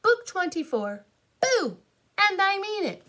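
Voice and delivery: punchy, high storybook title read; spirited title announcement. A narrator's reading. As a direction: belt out the title like a spook. Reads out the book title.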